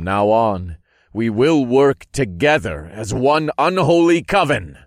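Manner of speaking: loud, high, building to a climax; rally the crowd with rising force; triumphant, ringing end of a speech; rallying proclamation. A narrator's reading. Rallying speech that draws applause.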